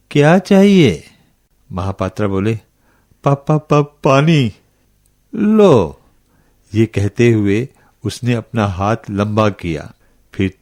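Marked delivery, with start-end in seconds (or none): 0.1-1.0 high-pitched, eerie questioning; ghostly old woman. A narrator's reading. Old woman with long nails to visitor, high crone voice. 3.2-4.5 higher-pitched, stuttering on the first syllable; frightened stammer. A narrator's reading. Traveler facing the ghostly old woman, scared stutter. 5.4-6.0 very high, falling one-word command; ghostly old woman. A narrator's reading. Old woman reaching out with water, high eerie voice.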